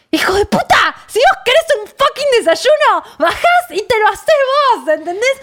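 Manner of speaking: high-pitched, hammed-up furious tirade; exploding in anger. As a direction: re-enact blowing up at a husband. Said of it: "no, no, exploté".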